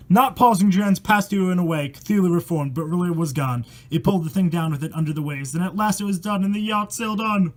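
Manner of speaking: steady rhythmic verse read aloud; poem reading. A narrator's reading. Reading a rhyming horror poem to viewers, rhythmic storytelling.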